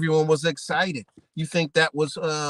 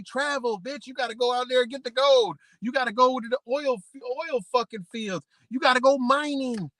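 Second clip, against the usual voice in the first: higher voice barking orders; mock officials ordering; 6 semitones higher than usual. Officials ordering people to move, hammed-up higher voice. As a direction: push them out to work the land. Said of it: "They say".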